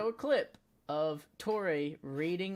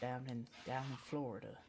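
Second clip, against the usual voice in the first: softer Florida panhandle accent; 3 semitones lower, 10 dB quieter than usual. Shown as a demonstration. Showing his cohost his Florida panhandle accent. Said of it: "more of a panhandle accent".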